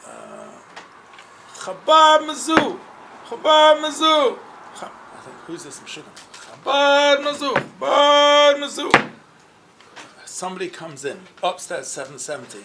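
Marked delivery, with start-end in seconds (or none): 1.5-4.4 high, drawn-out chant of "Chabad Mizzou"; mock chant, loud and high; imitated yelling. Visitor yelling slogan, imitated. 6.6-9.1 high, drawn-out chant of "Chabad Mizzou"; mock chant, loud and high; imitated yelling. Visitor yelling slogan, imitated.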